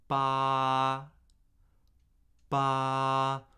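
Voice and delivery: "Ba" held high, flat and unchanging; first tone. Shown as a demonstration. Teacher models Mandarin first tone for learners, steady and high. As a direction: sing "Ba" level at the top of the range. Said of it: "high end of my normal speaking range".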